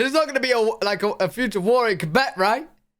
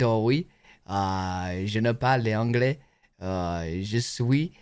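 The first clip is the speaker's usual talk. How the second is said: low, drawn-out pretend French; mock French accent; 9 semitones lower, slower than usual. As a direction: fake a French accent with scraps of French. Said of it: "French accent?".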